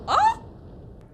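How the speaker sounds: loud startled high gasp; sharp high shocked gasp. Real, not acted. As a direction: gasp in alarm. Shock at sudden scene.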